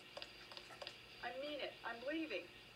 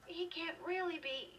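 high, slow cry of disbelief; anguished and high-pitched; distraught disbelief; 10 semitones higher, 4 dB louder than usual. Acted. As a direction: plead against the terrible news, drawn out. Daughter reacting to father's death.

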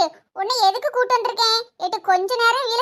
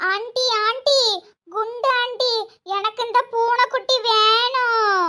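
put-on slow, drawn-out little-kid pleading; five-year-old child; slower than usual. Asking auntie for a kitten, childlike. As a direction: talk like a small child, stretching the words. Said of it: "அஞ்சு வயசு குழந்தைதான்".

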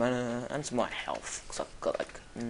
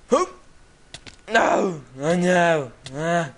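loud wail of dismay; panicked shout; 2 semitones higher, 10 dB louder, much slower than usual. Real, not acted. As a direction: yell out as it goes wrong. Dying or falling in game.

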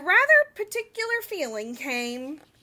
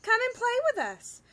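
calling; 8 semitones higher than usual. Acted. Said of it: "they would call".

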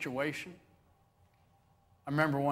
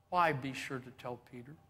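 ordinary talk, nothing marked

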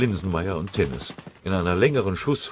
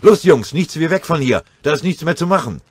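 drängender Ruf; 10 semitones higher, 9 dB louder than usual. Acted. Oberfeldwebel treibt Soldaten zur Flucht.

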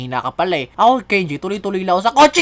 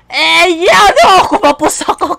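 frustrated yell; 8 semitones higher, 7 dB louder, slower than usual. Real, not acted. Misses a jump in the game.

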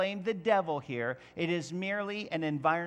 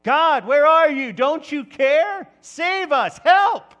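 panicked shouting, far higher and louder; freaking out; 10 semitones higher, 16 dB louder, slower than usual. Retold or imitated. Preacher acting disciples freaking out in the storm, a panicked high shout. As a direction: cry out to God in a full panic. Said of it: "freaking out".

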